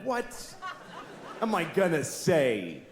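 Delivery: ordinary talk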